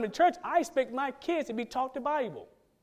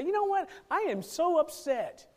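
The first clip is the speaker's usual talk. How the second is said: higher, slow complaining voice; higher mock complaint; upset churchgoer; 8 semitones higher, slower than usual. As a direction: play an aggrieved believer. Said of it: "Christians, say".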